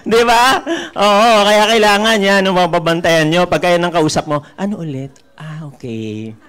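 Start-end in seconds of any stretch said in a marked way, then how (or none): 4.6-6.4 softer, lower patient reply. Retold or imitated. Talking patiently to a sensitive teammate, soft and low. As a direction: keep it gentle and calm. Mild acceptance to sensitive person.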